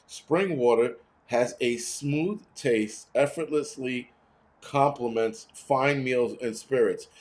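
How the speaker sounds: slow, plush commercial voice, savouring each word; slow, hammed-up mock ad read; mock fancy ad read. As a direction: read the label like a luxury ad. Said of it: "check this bullshit out".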